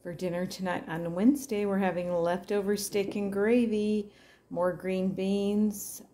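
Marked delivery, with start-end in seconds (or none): none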